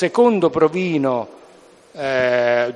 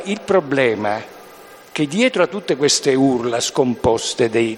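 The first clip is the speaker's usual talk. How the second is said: ordinary talk, nothing marked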